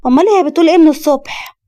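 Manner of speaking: exasperated mother. Acted. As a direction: scold a son who wasn't listening.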